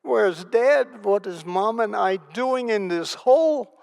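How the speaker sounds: put-on high, loud, frightened child's questions; child's fear. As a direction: relive a scared little kid's panic. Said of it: "what scared me is".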